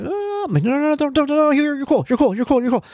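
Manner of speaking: put-on high, rushed pleading to settle a child; panicked soothing. Dad hurriedly calming his son near the classroom, high panicked soothing.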